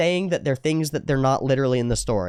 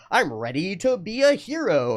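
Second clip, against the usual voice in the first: higher, slow heroic declaration; Power Rangers; 7 semitones higher, slower than usual. Acted. Hero about to transform, slow mock-heroic declaration. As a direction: proclaim it like a hammed-up hero. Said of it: "the Power Rangers go".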